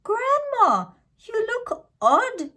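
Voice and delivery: put-on girl peering at a strange grandma; puzzled little girl. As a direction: sound small and unsure. Girl puzzled by disguised wolf.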